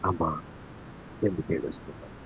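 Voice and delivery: low, soft, tender question; gentle consoling. Acted. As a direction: ask softly, as if comforting someone in tears. Voices Jesus comforting weeping Mary. (మృదువుగా ఓదారుస్తూ)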